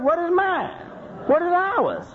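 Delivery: high, lightly put-on woman's voice; a hint of a complaining wife. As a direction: mimic a woman asking what's hers. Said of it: "That's usually a woman talking like that".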